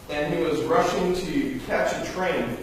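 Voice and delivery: ordinary talk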